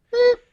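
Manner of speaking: short high beep; imitated censor beep. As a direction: mimic a bleep over a swear word. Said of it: "it wasn't a beep".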